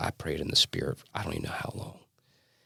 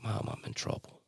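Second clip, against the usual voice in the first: tearful whisper; 6 semitones higher, 9 dB quieter than usual. Retold or imitated. Tearful confession to mother.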